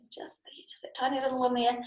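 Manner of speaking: hushed whisper pointing out something tiny. Real, not acted. Teacher whispers to children about the tiny flea. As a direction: lean in and whisper.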